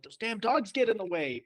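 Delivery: playful grumble, pitched well up; mock exasperation. Athlete griping about beloved dogs on the course, mock exasperated. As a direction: complain in hammed-up annoyance.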